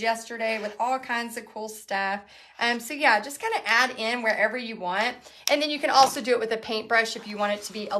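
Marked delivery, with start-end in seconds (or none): none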